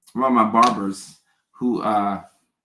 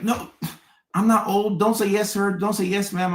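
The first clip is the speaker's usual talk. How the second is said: higher-pitched imitation of people objecting; mock protest; 8 semitones higher than usual. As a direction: lightly act out an offended correction. People protesting at being called sir.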